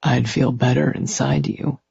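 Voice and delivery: softly purred male lover; purring. A narrator's reading. Man coaxing his lover, purring. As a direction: purr it in a seductive undertone. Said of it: "he purred".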